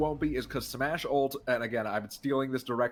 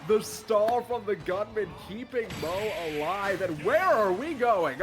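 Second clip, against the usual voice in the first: hyped commentary; 7 semitones higher, 4 dB louder, slower than usual. Real, not acted. Hyped play-by-play.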